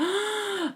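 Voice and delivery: high sharp intake of breath; sharp gasp. Retold or imitated. Realising octopus lady took the bags, sharp gasp. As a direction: gasp in shock.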